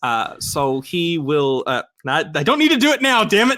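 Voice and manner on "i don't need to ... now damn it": higher, louder comic protest; exasperated outburst. Real, not acted. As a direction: protest loudly at your own slip. Player exclaims at slip mid-narration.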